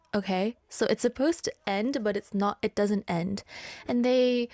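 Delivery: ordinary delivery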